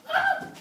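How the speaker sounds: high startled yelp; sudden yelp. Real, not acted. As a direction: yelp when hit. Hit by dart.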